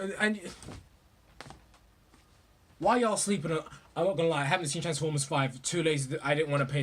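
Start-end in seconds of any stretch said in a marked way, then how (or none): none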